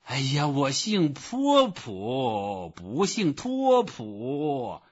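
high, slow, emphatic correction; exasperated roar. A narrator's reading. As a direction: stretch out both names in exasperation. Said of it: "波普先生吼起来了".

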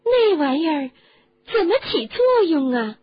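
high, loud, excited question; breathless with interest. A narrator's reading. Fascinated woman asking how the charm works, breathless and excited. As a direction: burst out high and loud, agog with curiosity. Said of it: "因为感兴趣而喘不上气来".

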